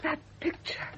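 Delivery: breathless whisper of shock; shocked gasp. Acted. Janet sees the new picture, breathless shocked whisper. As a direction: catch your breath and whisper it.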